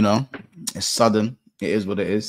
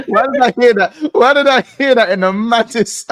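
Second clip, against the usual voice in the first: laughing; 14 semitones higher, 8 dB louder than usual. Real, not acted. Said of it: "in the maddest accent".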